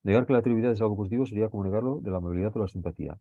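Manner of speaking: quick run through the text; fast reading aloud; fast reread. Shown as a demonstration. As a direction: rattle off the passage fast.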